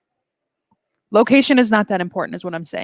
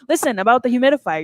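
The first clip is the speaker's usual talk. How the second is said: ordinary talk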